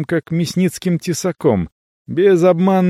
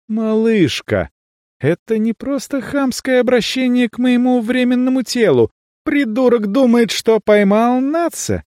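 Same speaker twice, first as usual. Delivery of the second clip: high excited inner voice; 9 semitones higher than usual. A narrator's reading. Narrator's inner voice raised.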